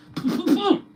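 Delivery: high loud burst of laughter; high squealing laugh. Real, not acted. Streamer bursting into a high laugh at his own joke.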